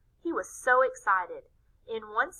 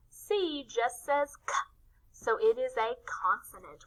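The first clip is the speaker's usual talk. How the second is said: usual speaking voice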